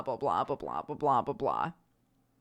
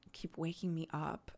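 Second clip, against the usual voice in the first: drowsy mumble; 3 semitones lower, 8 dB quieter than usual. Retold or imitated. Sleepy child sighing at mom.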